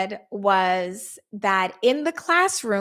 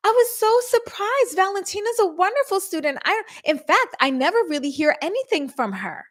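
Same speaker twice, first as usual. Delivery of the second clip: high, sweet surprised teacher; lightly mocking high teacher voice; teacher's voice; 7 semitones higher than usual. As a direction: mimic the teacher's sugary surprise. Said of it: "the teacher comes out and says".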